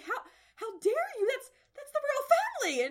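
high, loud indignant cry; outraged how dare you. Acted. Princess screeching at the mom for insulting royalty. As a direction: shriek it like an offended courtier. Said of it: "She's like".

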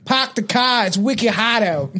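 slow, hammed-up Boston accent dropping the R's. Showing his co-host a Boston accent, mock Boston accent. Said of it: "That's kinda New York".

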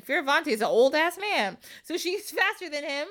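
ordinary talk

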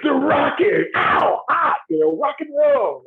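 high, shouted cheesy rocker voice; mock rock-star hype. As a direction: ham up a dumb rock-and-roll answer. Mocking a shallow rock-star answer.

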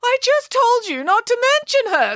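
very high, exasperated outburst; snapped. A narrator's reading. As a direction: snap shrilly at the children. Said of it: "snapped Nanny Piggins".

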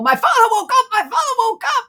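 very high, excited cry down a hall; hammed-up high-pitched screaming; mock screaming. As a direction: imitate a son yelling the astonishing news. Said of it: "screaming down the hall".

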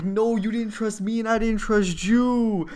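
mock melodrama. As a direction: proclaim doom like a hammed-up standoff. Dramatic standoff between two fathers.

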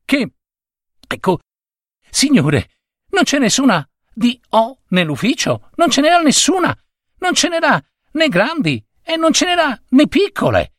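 put-on high, rushed, panicky boy's voice; frightened errand boy. A narrator's reading. Errand boy stammering the bad news to his boss, high and scared. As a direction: squeak it out fast and scared.

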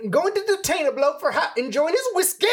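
hammed-up British accent, pitch climbing high at the end; British accent rising up. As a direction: push the question way up at the end. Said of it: "Just that up at the end really killed me".

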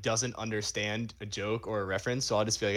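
usual speaking voice

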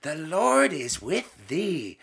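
high start, slow solemn recitation; imitating the nun. Nun reciting the prayer to the class, slow. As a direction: recite slowly and reverently as the nun.

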